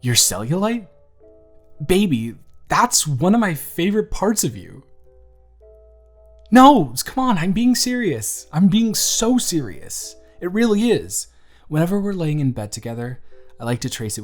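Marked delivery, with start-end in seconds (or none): 0.0-4.8 high, louder, surprised affection; incredulous reassurance. Acted. Reassuring partner who doubts body. 6.5-11.3 loud, high-pitched insistence; earnest protest. Acted. Protesting partner's disbelief.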